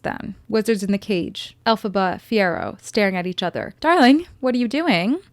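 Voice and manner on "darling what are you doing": Glinda voice. Retold or imitated. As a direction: lightly voice a prim Glinda. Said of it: "she says".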